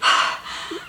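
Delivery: big breathy huff; out-of-breath exhale. Retold or imitated. Winded jogger in the germ-worried woman's face, exaggerated exhale. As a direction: puff air right into someone's face. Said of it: "I'm in her face like".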